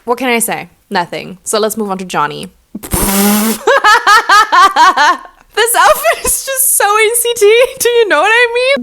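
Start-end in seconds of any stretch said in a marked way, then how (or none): 2.7-5.2 high laugh. Real, not acted. Laughing at an ugly outfit, a high giggle. 5.5-8.8 much higher, laughing through the words; laughing disbelief. Real, not acted. Reviewing an ugly outfit, squealing with laughter.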